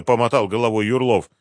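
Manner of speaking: usual speaking voice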